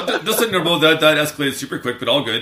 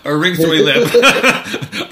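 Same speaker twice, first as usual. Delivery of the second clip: slow, laughing through the words; 6 semitones higher, 9 dB louder, much slower than usual. Real, not acted. Laughs at own slip.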